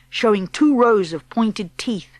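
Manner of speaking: plain reading voice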